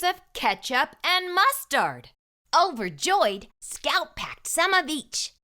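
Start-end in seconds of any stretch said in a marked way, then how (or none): none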